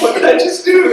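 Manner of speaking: high, loud panicked voice; freaking out. Retold or imitated. Girl watching her convert cry and laugh, mock freak-out. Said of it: "she's just kinda freaking out".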